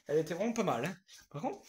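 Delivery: ordinary talk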